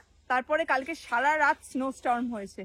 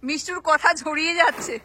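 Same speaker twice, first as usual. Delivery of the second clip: repeated much louder to be heard; 7 dB louder than usual. Real, not acted. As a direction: say it again loud so they hear. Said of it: "হ্যাঁ?".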